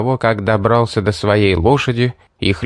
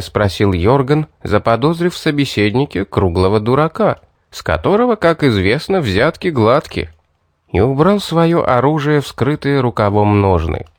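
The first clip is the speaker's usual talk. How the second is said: ordinary delivery, nothing marked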